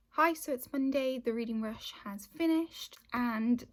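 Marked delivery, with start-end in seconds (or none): none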